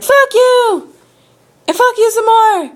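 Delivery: high, loud furious retort; angry shouting. Retold or imitated. Customer curses out tow lady, angry shout. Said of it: "getting more and more angry".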